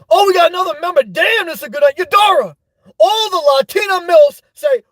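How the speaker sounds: thrilled, high-pitched burst of hype; excited. Real, not acted. Streamer hyping a new member to viewers, excited.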